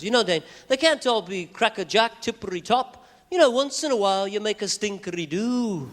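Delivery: put-on high, sing-song British accent. Comfortable Beatle shrugs off album question, British lilt. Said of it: "[name], how do you think".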